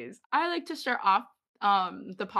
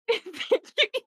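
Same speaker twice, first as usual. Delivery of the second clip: high, loud laughing thanks; 6 dB louder than usual. Real, not acted. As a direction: say it through a burst of laughter. Cracking up after group's greetings.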